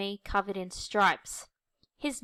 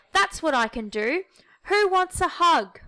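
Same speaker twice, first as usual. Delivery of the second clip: much higher, loud happy call; joyful offer; 7 semitones higher, 5 dB louder than usual. Acted. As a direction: call out to everyone with joy. Humphrey joyfully offers hugs.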